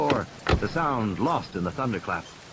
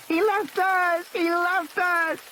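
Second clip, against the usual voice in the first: high, slowing wail of panic; screaming child; slower than usual. Acted. Lex screams after the man who left them. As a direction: wail it like a terrified little girl. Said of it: "she had started to scream".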